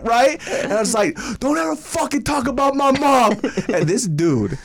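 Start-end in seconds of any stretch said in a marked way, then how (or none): 1.4-3.3 high-pitched indignant outburst; hammed-up furious retort; angry warning. Snapping at a friend over a mom joke, acted anger.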